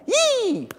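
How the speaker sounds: high squeaky yelp of dismay; a hammed-up high yelp; mock squeal. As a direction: squeak like you're dreading it.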